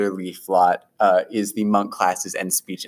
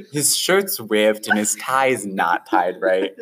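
ordinary talk, nothing marked

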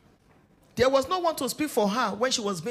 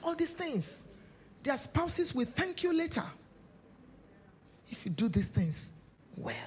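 ordinary talk

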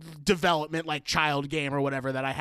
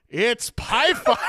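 slow, booming grandpa imitation, half-laughing; big voice; 5 semitones higher, 5 dB louder, much slower than usual. Grandfather quoting the game to the kid, big slow voice. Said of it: "in this, like, big voice".

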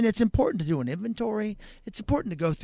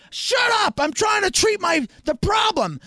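high, loud snap back; mock angry shout; 11 semitones higher, 6 dB louder than usual. Fireman to homeowner, loud mock annoyed shout. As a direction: bark it defensively.

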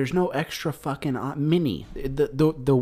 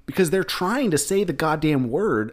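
ordinary talk, nothing marked

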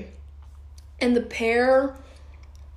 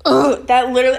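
loud, exasperated outburst; getting heated; 8 dB louder, faster than usual. Real, not acted. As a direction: burst out in real irritation. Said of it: "I'm getting heated".

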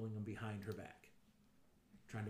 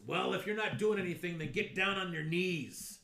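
louder, higher stern order; commanding; 6 semitones higher, 6 dB louder than usual. Acted. GM voicing the attacked guard to the player, stern loud order. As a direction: bark it as a threat. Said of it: "the guy that was attacked before says".

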